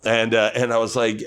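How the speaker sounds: ordinary talk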